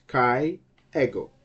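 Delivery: KAI, EGO, each word whole; two separate words. Shown as a demonstration. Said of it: "these two words".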